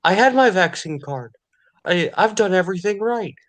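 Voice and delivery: high, whining mimicry of a vaccinated person; mock them as a pleading, whiny victim. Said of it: "and try to say".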